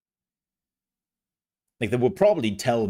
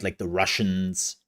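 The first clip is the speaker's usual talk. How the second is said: ordinary talk, nothing marked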